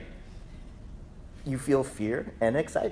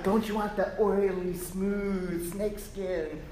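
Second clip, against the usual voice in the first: coaxing voice; 4 semitones higher, 7 dB quieter than usual. Pretend. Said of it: "over here is like".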